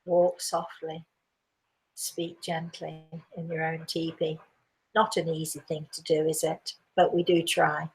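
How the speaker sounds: soft, low, gentle delivery; hushed. Real, not acted. Host sharing a gentle saying with listeners, hushed.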